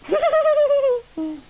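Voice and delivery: high, loud squealing cry; startled squeal. Acted. Game character startled in the basement, high squeal. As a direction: let out a high squeal of alarm.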